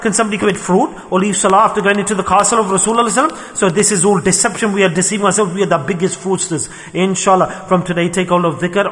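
ordinary delivery

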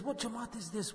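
imitating onlookers in a very soft voice; hushed wonder. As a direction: marvel under your breath, as if quoting. Onlookers marvel, eyes open wide.

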